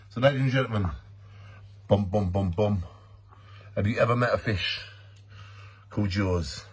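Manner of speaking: deep mock-epic fish presentation; mock dramatic announcer. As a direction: present the fish like a grand movie monster. Resumes mock Jaws presentation.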